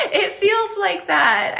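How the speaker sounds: high giggle, words stretched slow; laughing. Real, not acted. Laughing at a funny name.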